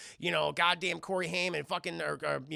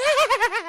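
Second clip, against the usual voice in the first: high, loud imitated cackle; shrieking gang laughter; cackling laugh; 12 dB louder than usual. Vampire gang greets the newcomer, cackling.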